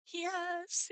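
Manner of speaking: high, thrilled yes; excited yes. Retold or imitated. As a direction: cheer it out. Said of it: "I was just like".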